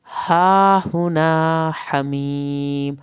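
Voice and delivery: stretched tajweed recitation; Quran recitation. Shown as a demonstration. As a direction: chant it out slowly with a full heavy ṭā. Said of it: "کھڑی زبر کو ہم نے کھینچا ہے".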